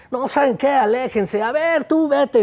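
haughty, high dismissal; snooty. Acted. Snooty tree sending the animals away, high and haughty. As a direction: shoo them off with disdain. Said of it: "muy sangrón".

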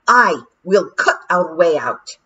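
rally the crew to fight; shouted. Acted. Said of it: "shouted Daft Woolly".

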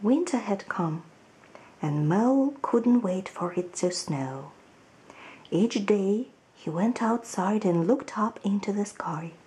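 put-on lively storybook reading for children; story narration. A narrator's reading. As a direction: read it like a picture book to little ones. Teacher reads children's story aloud.

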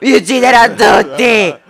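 high, loud yell; full-force high-pitched shout; screamed threat. Acted. Threat in armed standoff.

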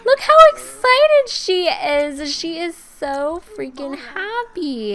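high, slow, delighted gushing; thrilled, high-pitched cooing; gushing excitement. Real, not acted. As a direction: squeal with drawn-out happiness. Thrilled at character's engagement.

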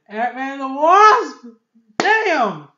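loud, high, building to the last word; hyped exclamation. Real, not acted. Fan listing upcoming Marvel films, hyped exclamation. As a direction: announce it with fired-up excitement. Hyping upcoming film title.

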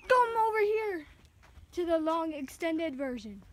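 calling out. Real, not acted. Loud call, then slow.

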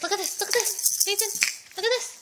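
high sing-song coaxing. Real, not acted. Getting baby's attention.

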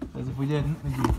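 ordinary delivery

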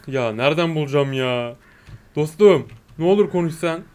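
normal talking voice

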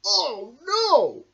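high, loud cartoon "oh no"; squeaky alarmed voice; high cartoon cry. Dog pushes bear in bin.

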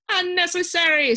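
loud, high-pitched pronouncement; emphatic official. A narrator's reading. Earl outdoing the other advisors, emphatically dismissive. Advisors' rapid chorus of refusals.

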